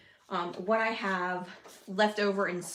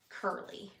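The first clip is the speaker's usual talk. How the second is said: ordinary talk, nothing marked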